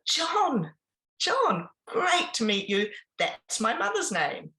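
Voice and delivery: milk the joke like a showman; high, hammed-up gushing host voice; delighted host punchline. Speaker's punchline joke to husband.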